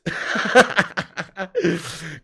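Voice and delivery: long chuckling laugh. Real, not acted. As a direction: burst out laughing at a slip of the tongue. Laughs at own slip.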